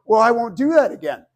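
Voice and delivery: loud, high, emphatic promise; mock quarrel. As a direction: play a partner conceding at full volume. Acts out a couple's quarrel.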